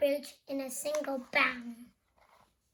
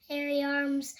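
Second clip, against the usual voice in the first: ordinary delivery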